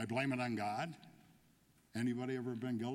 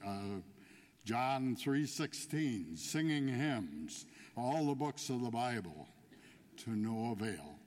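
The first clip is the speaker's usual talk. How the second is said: usual speaking voice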